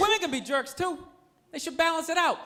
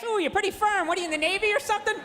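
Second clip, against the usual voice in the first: pitched-up imitation of an admiring old lady; 7 semitones higher, faster than usual. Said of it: "rich old lady came walking".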